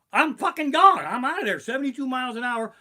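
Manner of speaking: loud, high, terrified coaster panic; panicked yell. Retold or imitated. On the roller coaster, yelling in terror. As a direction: scream it like the ride just launched.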